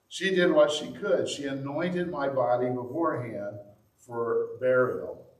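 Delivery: ordinary delivery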